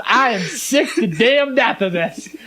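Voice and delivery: hammed-up slow exasperation; retold exasperation. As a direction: imitate a fed-up dad, drawing out each word. Retold fed-up father confronts girl.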